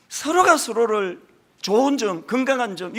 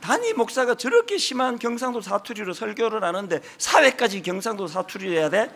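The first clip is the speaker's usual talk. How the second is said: ordinary talk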